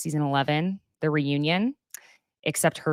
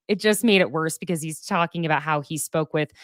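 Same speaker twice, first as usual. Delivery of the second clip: ordinary talk, nothing marked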